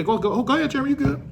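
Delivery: high, obliging teacher waving him off; teacher's voice. Retold or imitated. Teacher letting Jeremy go to the bathroom, high mock voice.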